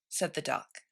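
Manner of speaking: ordinary delivery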